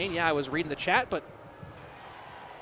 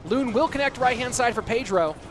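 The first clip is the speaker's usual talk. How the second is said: loud, excited caster call; play-by-play casting; 5 semitones higher, 4 dB louder than usual. Acted. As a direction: hype up the push like a live caster. Live esports play-by-play.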